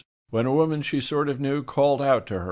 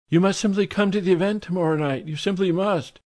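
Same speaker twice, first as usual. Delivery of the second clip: high, quick, insistent society lady; high society lady insisting quickly; pushy socialite; 10 semitones higher than usual. A narrator's reading. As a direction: press her to come, sing-song and brisk.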